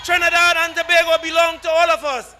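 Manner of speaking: loud, fiery rally chant; roaring rallying speech. Real, not acted. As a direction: rouse the crowd at full volume.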